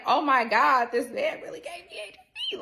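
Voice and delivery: loud, high-pitched retold wail; replayed meltdown, pitch climbing sharply; distraught outburst. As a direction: break into a hammed-up high wail. Distraught reaction to being infected.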